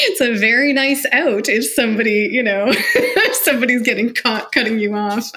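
amused at the virtual-meeting excuse, laughing as she speaks; laughing while talking. Real, not acted. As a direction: still laughing as the words come. Laughing through the line.

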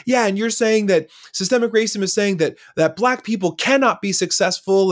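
mock heated uncle; angry uncle. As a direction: push back hotly like the uncle. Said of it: "he was still angry".

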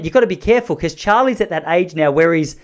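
normal talking voice

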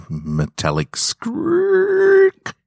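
ordinary delivery, nothing marked

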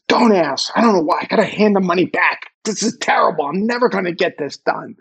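hammed-up writer's despair; moaning to his partner about the book, mock-whiny; mock despairing whine. Retold self moaning to partner.

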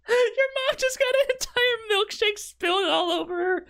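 high, laughing retelling of dad; quoting dad laughing. Retold or imitated. Kid retelling dad's words, high and giggly. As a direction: mimic dad through laughter.

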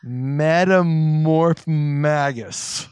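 "Metamorph magus" very slow and loud; slowed right down. Shown as a demonstration. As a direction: drag out every syllable of "Metamorph magus". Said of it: "I just slow it down".

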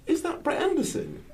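high-pitched retold disbelief; high, amazed voice-in-the-head; starstruck inner thought. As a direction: squeal it to yourself, retelling a celebrity sighting. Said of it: "I think to myself".

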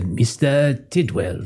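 slow, shaky attempt at British Scottish; lightly put-on British Scottish accent, drawn out. Game master as Mac calling out, uncertain British Scottish accent. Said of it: "like, a British Scottish accent".

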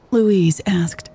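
ordinary delivery, nothing marked

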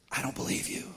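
breathy unvoiced put-down, a joke; deadpan whispered retort. Wife teasing husband who promises careful words, dry whisper. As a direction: toss it off in a half-whisper, teasing. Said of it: "look at your husband and say this".

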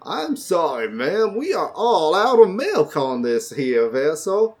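lightly put-on Southern bartender; Southern accent. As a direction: apologize in a mild Southern accent. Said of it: "doing the worst Southern accent".

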